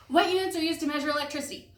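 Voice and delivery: really fast. Shown as a demonstration. Teacher racing students through electricity units, really fast. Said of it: "let's try to do it really fast".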